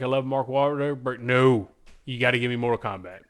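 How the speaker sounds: ordinary talk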